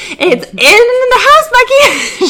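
high, loud fed-up shout; exasperated. Retold or imitated. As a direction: nearly yell it in disbelief. Exasperated repeat to Becky.

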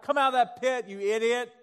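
harsh mock scolding. Preacher voicing a harsh God yelling at a sinner, scornful. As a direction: bark it like a harsh father scolding a fool.

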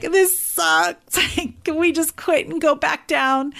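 high, whiny imitation of crying; mock sobbing. Exhausted hiker begging to quit, imitated tearful whine. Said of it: "here I am sobbing".

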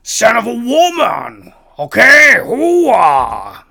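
loud high barking hoo-ah; Al Pacino impression. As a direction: shout it like a hammed-up Pacino. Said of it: "I sound like Al Pacino".

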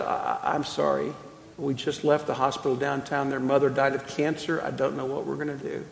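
soft, low, halting apology; grieving father. Retold or imitated. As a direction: speak quietly, as if stunned by a fresh loss. Grieving father voiced in a story.

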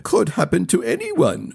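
smooth it over quickly; Cowa's quick reassurance. A narrator's reading.

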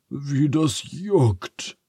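slow, high-pitched moan; pitiful story-book wail; whining. A narrator's reading. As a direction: whine it out, drawn long. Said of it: "jammert Phineas".

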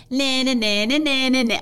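high sing-song nah nah taunt; taunting little sister. Retold or imitated. Little sister calling to gloat, high sing-song taunt. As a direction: tease like a bratty kid sister. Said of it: "the typical little sister".

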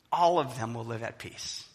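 usual speaking voice

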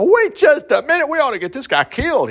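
high-pitched indignant objection; mock outrage. Preacher objecting to a divorce in the law, mock outrage. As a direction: object as if scandalized, hammed up.

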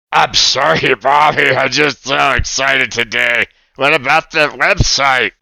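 excited puppet, laughing a little; lightly voiced giddy puppet boy; a hint of a cartoon puppet bubbling over; Oscar puppet voice.

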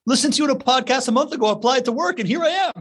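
high, chirpy mock newcomer voice; imitated eager newcomer. Host imitating new teammate introducing himself, high put-on voice. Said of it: "he just comes up and is like".

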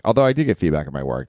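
ordinary talk

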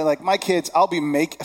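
usual speaking voice

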